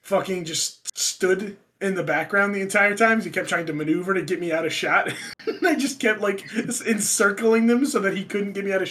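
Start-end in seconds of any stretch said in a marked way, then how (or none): none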